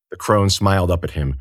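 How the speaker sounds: plain reading voice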